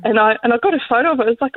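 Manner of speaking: ordinary talk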